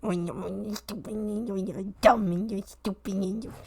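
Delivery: soft, muffled mock insults; mock grumbling frog. Imitating squabbling frogs in the swamp, mock grumbling. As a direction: grumble insults under your breath, playfully. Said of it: "and I'm like".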